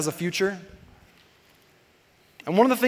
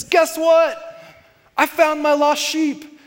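much higher and louder, then slow and beaming; joyful announcement; 6 semitones higher, 4 dB louder, slower than usual. Retold or imitated. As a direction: call out the good news to neighbours. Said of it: "he says".

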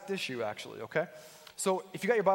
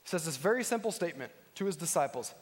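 usual speaking voice

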